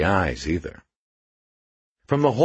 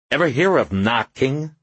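higher-pitched snappish question; irritated retort, pitched up; snapped; 7 semitones higher than usual. A narrator's reading. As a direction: snap it as a testy challenge. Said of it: "the ghost snapped".

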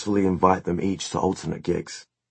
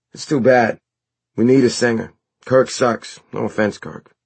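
higher, louder dude voice; blunt bandmate Matt; 6 semitones higher, 6 dB louder than usual. A narrator's reading. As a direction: deliver the blunt verdict as a bandmate. Plays Matt in the story.

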